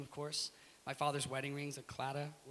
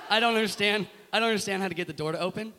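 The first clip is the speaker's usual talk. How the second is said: raised, louder baffled plea; helpless confusion; 6 semitones higher, 10 dB louder, faster than usual. Retold or imitated. Confused tourist asking the kiosk man, comically helpless. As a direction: play up the hapless, confused tourist.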